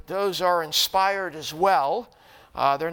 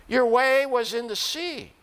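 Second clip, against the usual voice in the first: declaimed psalm, phrase by phrase with long pauses; solemn scripture reading; 5 semitones higher than usual. Acted. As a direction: read the lament out to a congregation, measured and emphatic. Reads Psalm seventy-seven aloud.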